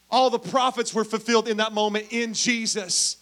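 loud, emphatic pulpit declaration; preaching at full force. Acted. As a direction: hammer it home to the congregation.

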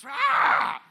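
big high yell as she throws; mock battle cry of a wife throwing a ball; wife's wind-up yell. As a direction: hurl the ball with a loud high shout. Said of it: "my wife is just vicious again. It just winds up".

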